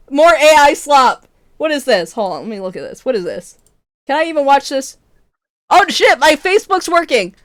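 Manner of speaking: ordinary delivery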